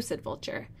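usual speaking voice

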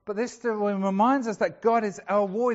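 usual speaking voice

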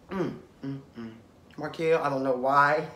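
low hum of offended disbelief; stunned disbelief. Real, not acted. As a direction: take it in, then shake your head. Shocked pause at insult.

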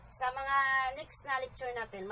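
usual speaking voice

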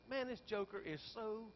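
ordinary delivery

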